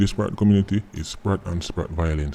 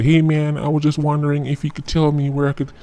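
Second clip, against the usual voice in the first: put-on higher, friendly American tourist accent; mock American tourist; 7 semitones higher than usual. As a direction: play the polite American visitor asking directions. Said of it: "If Billy Joe walk up to him and say".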